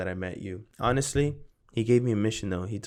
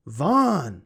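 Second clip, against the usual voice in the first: loud, high shout of a last name; a sudden loud yell to get his attention; 5 semitones higher, 10 dB louder than usual. Retold or imitated. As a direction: call him out across the room. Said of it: "I yell".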